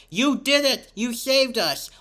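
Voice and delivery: high-pitched cry of relieved triumph, acted; excited cheer. Villain thanking Perry for saving them, excited cheer. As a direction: exclaim it like a rescued cartoon villain.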